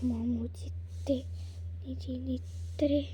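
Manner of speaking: slow teasing whisper behind her back; secret whisper. Acted. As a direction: breathe it so the sister won't hear. Kids tease the sister secretly.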